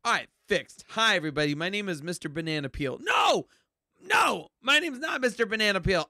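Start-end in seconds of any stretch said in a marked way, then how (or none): 3.0-6.1 high shouted denial; loud protest. Pretend. Protestiert gegen falschen Filter.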